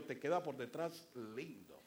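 tempter's sly compliment; devil's voice. Retold or imitated. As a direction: flatter her with a sly chuckle. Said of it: "pero me dijo".

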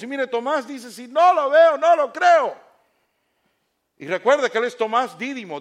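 loud, high, emphatic sermon; fiery preaching. Acted. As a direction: preach it at full force to the congregation. Preacher rises to full force.

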